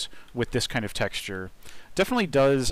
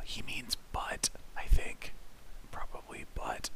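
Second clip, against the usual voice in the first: breathy whisper, joking aside; 6 dB quieter than usual. Real, not acted. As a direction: lean in and whisper the punchline. Whispered aside about the author's joke.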